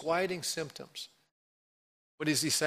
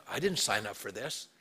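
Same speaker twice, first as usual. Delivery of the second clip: much lower, softer sulky complaint; grumbling complaint; 8 semitones lower, 5 dB quieter than usual. Retold or imitated. Believer complaining to God, low sulky grumble. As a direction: mutter it like a reluctant believer. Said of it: "You're going".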